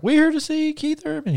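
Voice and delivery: high falsetto fangirl voice; mock girly squeal in words; imitating fangirls. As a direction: mimic a crowd of girls in falsetto. Voicing the waiting girls.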